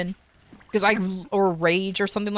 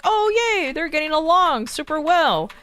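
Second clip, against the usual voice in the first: high, mock-chipper forced happiness; sarcastic over-bright delight; fake cheer; 7 semitones higher than usual. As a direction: gush over it like you're thrilled, but you're not. Fake cheer over friends pairing up.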